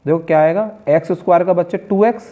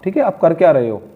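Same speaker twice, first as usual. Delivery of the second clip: usual speaking voice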